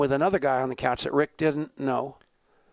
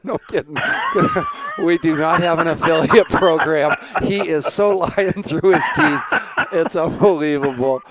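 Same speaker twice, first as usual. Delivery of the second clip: laughing; 3 semitones higher, 7 dB louder than usual. Real, not acted. Host laughing at guest's joke.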